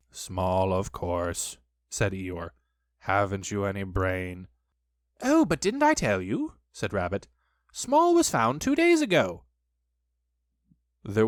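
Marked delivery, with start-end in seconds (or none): 0.1-1.6 low-pitched glum donkey; gloomy Eeyore. A narrator's reading. Eeyore answering Rabbit, voiced low. 3.0-4.5 low, dour monotone; scornful Eeyore. A narrator's reading. Eeyore scorning Rabbit, voiced low. 5.2-6.5 high-pitched, breezy surprise; surprised Rabbit. A narrator's reading. 7.7-9.4 high, loud announcement; breezy Rabbit. A narrator's reading. Rabbit announcing news, voiced high.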